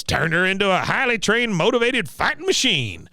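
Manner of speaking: high proud veteran impression; boastful old soldier voice; Cotton. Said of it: "He goes".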